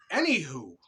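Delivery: ordinary talk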